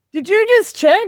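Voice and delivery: mock-incredulous corporal, high and loud; oskarżający kapral. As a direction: pounce on a recruit caught out, imitating a corporal. Corporal catches recruit looking, retold.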